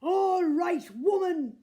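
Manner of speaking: big booming giant's bellow; loud booming giant voice. A narrator's reading. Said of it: "bellowed the giant at the door".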